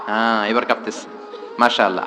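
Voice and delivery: normal talking voice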